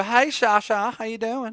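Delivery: high-pitched southern greeting; southern accent. Acted. Host greeting Shaheen playfully, southern accent. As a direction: call out to him in a southern twang. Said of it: "has an accent like that".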